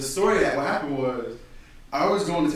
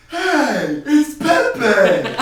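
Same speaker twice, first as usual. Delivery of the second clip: loud, high-pitched holler; excited party-goer yell; 11 semitones higher, 12 dB louder, much slower than usual. Retold or imitated. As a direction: shout it across a crowded room. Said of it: "And then one dude goes".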